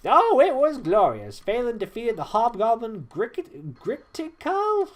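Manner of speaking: high, loud, drawn-out relish; rapturous storytelling. Acted. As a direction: draw out the tale in high excitement. Traherne relishing a glorious battle tale.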